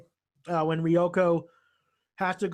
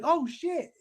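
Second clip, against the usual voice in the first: high-pitched startled swear; 11 semitones higher than usual. Retold or imitated. Washu is caught by Mihoshi, startled. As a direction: imitate someone caught in the act. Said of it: "Fucking just startled".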